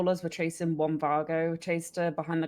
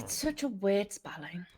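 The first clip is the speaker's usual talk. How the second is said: whisper; 3 semitones higher than usual. Real, not acted. Side whisper during the briefing.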